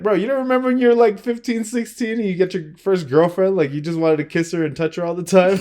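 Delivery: high, loud, exasperated appeal; retold incredulous protest. Arguing with gym buddy over young couple, incredulous protest. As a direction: re-enact pleading your case loudly, voice shooting up.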